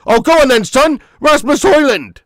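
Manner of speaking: loud, high commentator yell; excited urging. Real, not acted. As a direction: roar the striker on.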